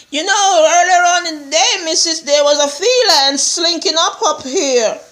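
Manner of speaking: high-pitched servant reporting in; servant telling on someone. Retold or imitated. Servant tells the missus what was seen, high imitated voice. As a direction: tell it high, as an imitated informant.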